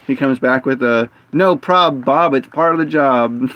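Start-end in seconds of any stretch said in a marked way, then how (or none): 1.3-3.5 upbeat, punchy imitated coworker; retold chipper reply. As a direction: toss it off cheerily, slightly higher.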